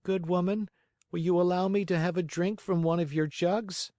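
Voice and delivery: put-on high-pitched puppet boy asking a favour; polite little Pinocchio. A narrator's reading. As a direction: plead politely in a high boyish voice.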